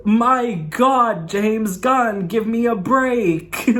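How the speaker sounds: high-pitched exasperated outburst; mock exasperation. Real, not acted. Fan pleading with the director over an emotional movie, mock-exasperated.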